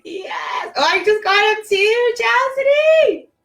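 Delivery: very high, loud squeal to a friend; joyful shriek. Real, not acted. Calling out to Chastity, high loud joyful shriek. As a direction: scream with joy at her name.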